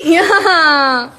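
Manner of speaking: high, loud burst of laughter; shrieking laugh. Real, not acted. Embarrassed after the baby-talk attempt, shrieking with laughter.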